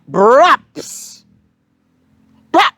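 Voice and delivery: "braps" snapped out with a rising lift, then a short "brap"; sudden braps. Shown as a demonstration. Guest tells of a taxi cutting in, sudden "braps". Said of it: "there's a suddenness to that word".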